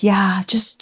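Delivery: whispered aside; a hushed judge's aside. Retold or imitated. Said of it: "the other judge was like".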